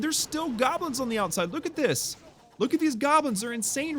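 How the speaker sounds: excited exclamation. Real, not acted. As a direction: point out the goblins in delight. Marvelling at goblins.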